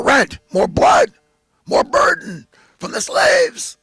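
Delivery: very high screeching then slow rasping landlord; mock landlord climax. Mocking the landlord demanding blood from rent slaves, screeching. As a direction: squeeze out his greed at the top.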